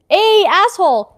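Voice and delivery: loud, high, drawn-out hail; calling back. A narrator's reading. Friend answers Felix with a smirk, calling back. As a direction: throw the insult back loudly.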